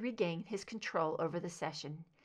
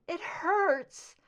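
higher, pained moan; 10 semitones higher, 6 dB louder than usual. A narrator's reading. Scully to Mulder, moaning in pain. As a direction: let the hurt out. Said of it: "She moaned again".

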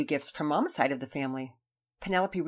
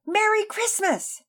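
excited; 9 dB louder, slower than usual. A narrator's reading. Sister greets brother excitedly.

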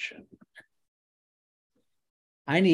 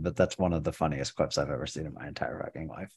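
ordinary talk, nothing marked

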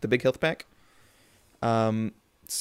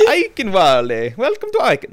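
Italian-sounding accent; 8 semitones higher, 7 dB louder than usual. Shown as a demonstration. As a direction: ham up an Italian accent, higher and louder. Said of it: "that's more Italian, actually".

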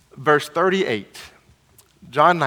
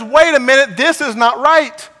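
higher-pitched retold objection; speaking up in protest; 6 semitones higher, 4 dB louder than usual. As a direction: object firmly as Nicodemus, voice lifted. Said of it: "Nicodemus speaks up".

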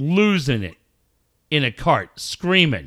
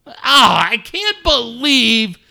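mock outrage; 8 semitones higher, 6 dB louder than usual. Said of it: "mock rant about parents".

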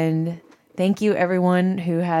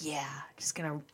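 whisper; 3 semitones lower, 17 dB quieter, faster than usual. Retold or imitated. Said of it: "you're like".

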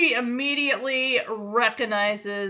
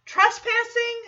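"Trespassing?" asked higher, disbelieving rise; incredulous; 10 semitones higher, 3 dB louder than usual. Retold or imitated. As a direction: scoff at the word "Trespassing". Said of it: "I'm like, "Wait a minute".

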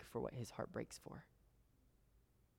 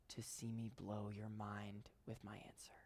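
whisper; 3 semitones lower, 11 dB quieter than usual. Acted. God's promise whispered.

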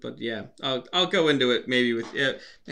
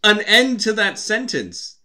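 slow, high-pitched insistence; exasperated rant; 10 semitones higher, 4 dB louder, slower than usual. Real, not acted. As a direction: hammer out the obvious, exasperated. Ranting about a sequel's name.

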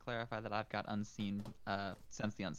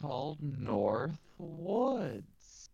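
glitchy, slow robotic audio; garbled robot voice over a bad connection; 10 dB louder, much slower than usual. Real, not acted. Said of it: "You've become a robot".

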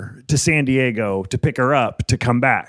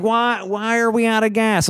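put-on much higher baffled old woman; mock Mitzi; 10 semitones higher than usual. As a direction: whine it like a confused boss. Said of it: "She's like".

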